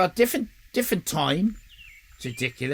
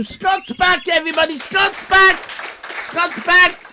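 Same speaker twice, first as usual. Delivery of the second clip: high, loud, delighted welcome; excited greeting; 12 semitones higher, 7 dB louder than usual. Real, not acted. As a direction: cheer him back in. Greeting a returning viewer.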